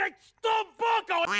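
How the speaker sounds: high-pitched holler; shouting to be heard. Acted. Asked to speak up through a door, shouting. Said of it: "Parle plus fort, Mathieu, il y a une porte".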